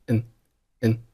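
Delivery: "n" as a reduced "and", just a short nasal sound; unstressed "n" in place of a full "and"; weak form. Shown as a demonstration. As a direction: shrink "and" down to a bare "n". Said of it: "and弱读以后读成了n".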